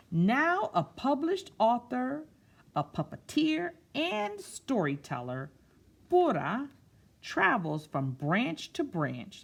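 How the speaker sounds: put-on slow, expressive read-aloud to children; storybook reading. A narrator's reading. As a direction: read it like story time, savouring each word. Reads picture book aloud.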